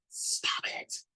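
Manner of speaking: whispered, sharp and tense; hissed. A narrator's reading. Narrator silencing Sandra at the table, hissing. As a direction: hiss a warning under the breath. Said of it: "I hissed".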